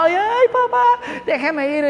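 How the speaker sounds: high, drawn-out pleading wail; imitated whining child. Son begging father after a refusal, long mock whine. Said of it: "cuando el hijo le llora".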